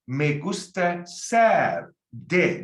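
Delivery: very slow, every syllable stretched; slow teaching demo. Shown as a demonstration. Teacher showing students the sentence, drawn out slowly. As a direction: spell it out in sound for beginners. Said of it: "teacher models Spanish phrase".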